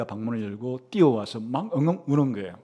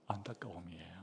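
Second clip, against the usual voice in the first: hushed whisper; 8 semitones lower, 18 dB quieter than usual. Real, not acted. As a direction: drop to a breathy whisper on 안타까움이에요. Preacher stresses child's pity. (안타까움이에요를 속삭이듯 낮게)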